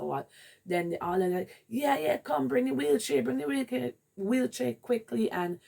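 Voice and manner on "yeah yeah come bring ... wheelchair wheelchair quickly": urgent staff. Retold or imitated. Staff rushing.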